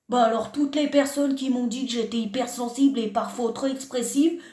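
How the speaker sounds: usual speaking voice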